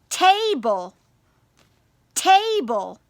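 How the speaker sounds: table in two drawn-out beats, ta-ble; syllable by syllable. Shown as a demonstration. Teacher asks which column, table syllable by syllable. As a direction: clap out table, stretching each syllable. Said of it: "practice clapping your syllables".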